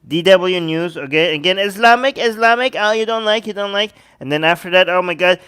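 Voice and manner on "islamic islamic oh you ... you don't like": higher-pitched mock objection; mocking complainers. Host teases viewers who dislike his sources, mocking. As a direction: needle the objectors in a raised voice.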